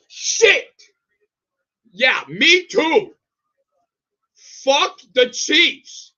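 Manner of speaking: loud, slow, bitter cursing; frustrated yell. Real, not acted. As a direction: spit it out slowly in anger.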